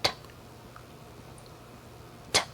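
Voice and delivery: isolated "T" released with a pop; true T pop. Shown as a demonstration. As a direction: pop the tongue off the ridge for "T". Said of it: "you make a popping noise".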